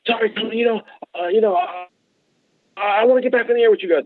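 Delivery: high, eager repeated pleading; eager pestering. Retold or imitated. Simon Carr pestering Tom to get on the air, eager high pleading. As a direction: nag him about airtime. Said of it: "Simon Carr saw me".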